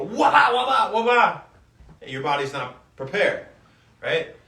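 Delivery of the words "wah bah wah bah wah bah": high, loud sped-up wah-bah noises; goofy imitated straining noises; mock rapid push-up grunts. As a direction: mimic someone rushing reps, hammed-up. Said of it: "hop on the ground right away and go".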